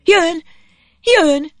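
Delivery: high, loud, excited exclamation; excited cry. A narrator's reading. Zsuzsika spotting the boat and jumping up, excited. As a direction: cry it out on a sudden leap. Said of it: "Egyszerre felugrott".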